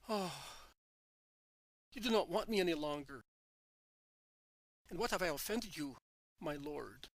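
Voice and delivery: a touch of tears, lightly voiced; tearful crying. A narrator's reading. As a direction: let the hurt show only mildly. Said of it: "he cries".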